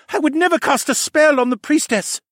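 high, loud, indignant mage voice; protesting mage. Acted. Captured mage denies harming priestess, loud protest.

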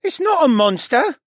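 put-on a sulky boy setting it straight; disgruntled boy. A narrator's reading. Stable boy correcting them, disgruntled and high. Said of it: "Ryland's disgruntled voice said".

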